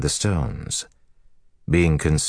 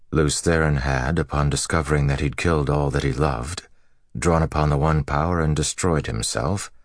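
ordinary delivery

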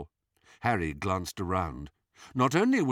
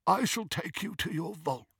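high, soft goblin voice; old goblin; 7 semitones higher, 5 dB quieter than usual. A narrator's reading. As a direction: offer it softly in a high goblin voice. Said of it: "said the old goblin".

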